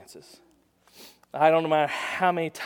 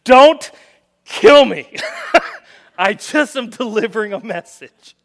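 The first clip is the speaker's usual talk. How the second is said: much higher, louder, pleading; pleading captain; 5 semitones higher, 10 dB louder, slower than usual. Acted. Third captain pleading with Elijah not to kill him, scared. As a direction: beg for your life, half laughing. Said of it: "this leader is a smart guy".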